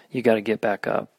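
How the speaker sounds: ordinary talk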